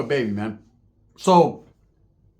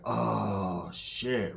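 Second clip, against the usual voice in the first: low, hushed, drawn-out realization; quiet dread; 5 semitones lower, 10 dB quieter than usual. Retold or imitated. Narrator hearing the serious summons, quiet dread. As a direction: let it sink out slowly, very softly.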